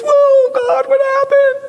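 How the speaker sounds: high startled cry; mock panic. Preacher acting a lost believer, mock startled cry. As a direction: yelp like the rug got pulled.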